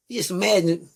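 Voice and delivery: ordinary talk, nothing marked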